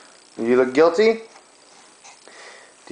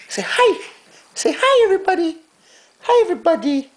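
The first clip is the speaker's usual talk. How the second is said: very high sing-song greeting; 8 semitones higher, faster than usual. Real, not acted. Father voices baby saying hi to viewers, high baby talk.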